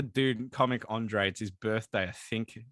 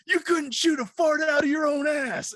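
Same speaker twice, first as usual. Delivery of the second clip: high, loud mocking taunt; John Marston insult; 12 semitones higher, 4 dB louder than usual. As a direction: hurl the insult mid-shootout. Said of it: "and he goes".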